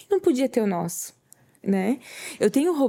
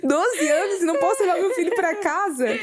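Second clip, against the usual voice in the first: real tearful laugh, voice breaking high; half laughing, half crying at the memory; 9 semitones higher than usual. Real, not acted. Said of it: "E eu fui chorando". (rindo e chorando de emoção)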